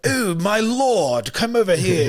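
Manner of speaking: high, drawn-out lordly put-on voice; mock medieval king. Mocking miscast actors playing royalty in a sword-fight movie, hammed-up lordly voice. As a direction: ham up a stiff period-movie king. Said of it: "Ben Affleck was the king ... They were like".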